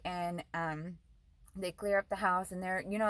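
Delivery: usual speaking voice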